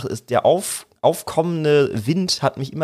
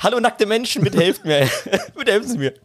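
höher, lachend flehen; lachend um Hilfe rufend; 6 semitones higher than usual. Retold or imitated. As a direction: halbernstes Hilferufen unter Lachen. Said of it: "dass ich halt sage, Hilfe". (lachender Hilferuf)

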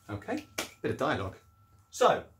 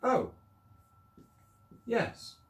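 Mister Jordan's reply; 11 semitones higher than usual. Acted. Rereading Mister Jordan's line.

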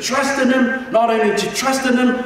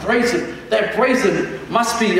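ordinary talk

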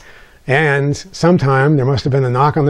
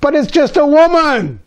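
put-on high, whiny little boy voice; boy sulking in his room, blaming his mother; whining child; 14 semitones higher, 4 dB louder than usual. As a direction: whine the accusation like a hurt kid.

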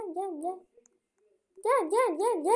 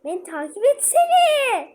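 slow, higher, louder call; 8 semitones higher, 9 dB louder, slower than usual. Real, not acted. Calling her brother to follow in the game, loud slow call. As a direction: drag it out, calling him over.

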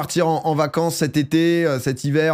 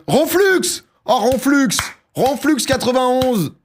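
high, loud announcer cry, last call drawn out; excited shouting; 10 semitones higher, 4 dB louder, slower than usual. Real, not acted. Streamer hailing the selected winner to the chat, excited shouting. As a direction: shout the name out high, stretching it.

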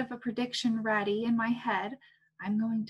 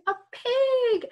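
pig; 13 semitones higher, 6 dB louder than usual. Acted. Teacher playing animals for kids.

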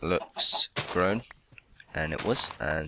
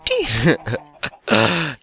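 laughing; 7 semitones higher, 7 dB louder than usual. Real, not acted. Laughs at own joke.

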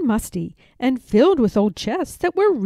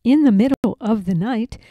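usual speaking voice

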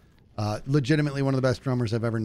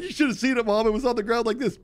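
boasting friend; 10 semitones higher than usual. Retold or imitated.

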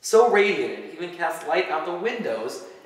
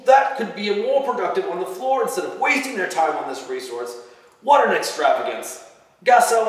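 louder, higher angry Santa; voice rising; 6 semitones higher, 4 dB louder than usual. A narrator's reading. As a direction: scold the elves, building in heat. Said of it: "his voice rising".

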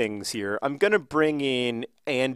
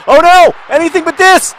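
high, full-volume wail of despair; desperate high shouting, real dismay; horrified screaming; 16 dB louder, slower than usual. Real, not acted. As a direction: scream in panic as disaster unfolds. Horrified by play in the match.

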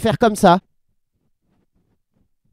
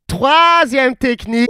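slow, higher, drawn-out announcing; showy announcement; 4 semitones higher, 4 dB louder, slower than usual. Acted. Host announcing the third technique, showy excitement. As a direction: stretch the first word like a big reveal.

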